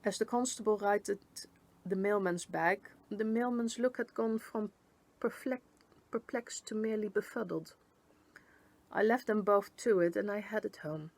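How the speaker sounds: ordinary delivery, nothing marked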